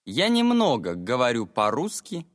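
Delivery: usual speaking voice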